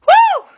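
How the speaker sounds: piercing, overwhelmed "woo"; sudden release of a whoop; loud high whoop. Real, not acted. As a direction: let out a big high woo. Overwhelmed exclamation telling story.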